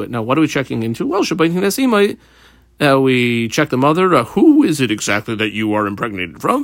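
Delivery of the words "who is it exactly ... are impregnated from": lower, probing questioner's voice; stern questioner. Acted. Judges questioning the pregnant woman, low and probing. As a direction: put the question to her like a judge.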